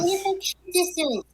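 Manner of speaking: high squeaky cooing; cutesy high voice. Real, not acted. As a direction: coo it like over a puppy. Echoing a cute reaction.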